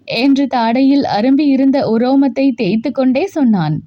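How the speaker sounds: plain reading voice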